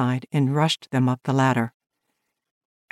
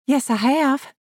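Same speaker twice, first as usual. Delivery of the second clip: lighter, higher woman's voice; young woman voice; 10 semitones higher than usual. Acted. Woman answers through the door.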